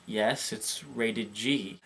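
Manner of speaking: slow, soft patient answer; soft, slow customer-service calm. Retold or imitated. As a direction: explain it patiently and slowly. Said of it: "being calm".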